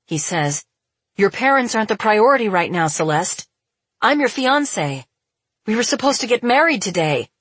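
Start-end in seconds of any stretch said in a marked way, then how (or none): 1.2-7.3 high, quick, louder exasperation; indignant protest. A narrator's reading. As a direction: protest with raised pitch and rising force. Acted quarrel with his fiancée.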